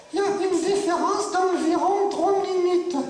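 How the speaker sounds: high, slow puppet punchline. Acted. Puppet answering her own riddle, slow high puppet voice.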